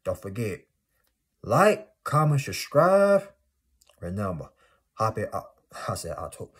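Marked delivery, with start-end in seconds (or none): 1.4-3.3 much higher and louder, drawn out; excited vlogger shouting like comment subscribe; hyped sign-off call. Real, not acted. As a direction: call it out like a hype man.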